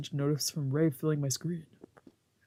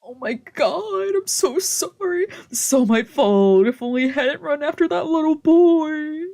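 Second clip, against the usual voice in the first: drama queen apology; 9 semitones higher, 7 dB louder than usual. Acted. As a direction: wail the apology like an overdramatic wife. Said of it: "my drama queen wife".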